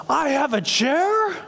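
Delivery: high, drawn-out amazed question; so excited. Retold or imitated. Groggy son learning from dad about the chair, so excited. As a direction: imitate a kooky patient's overjoyed disbelief. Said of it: "he's so excited".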